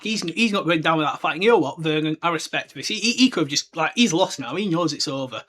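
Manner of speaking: ordinary talk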